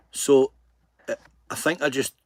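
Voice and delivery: ordinary delivery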